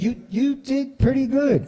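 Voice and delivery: high, bright mock advisor voice, well above his own; lightly voiced professor delivering good news; a high put-on voice for the advisor's praise; imitating the advisor. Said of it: "He said".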